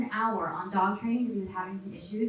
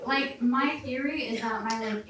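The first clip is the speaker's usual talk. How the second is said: usual speaking voice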